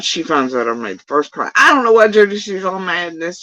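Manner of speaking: ordinary talk, nothing marked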